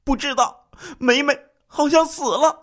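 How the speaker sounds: high-pitched, panicked reply; frightened husband crying out; terrified answer. A narrator's reading. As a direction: answer in sheer terror. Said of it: "万分惊恐的回答说".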